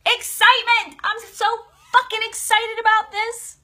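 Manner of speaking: excited. Acted. Coach demonstrates excitement to viewers, high thrilled burst. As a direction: explode with joy on the word. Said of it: "another way to identify if you're in a shift is excitement".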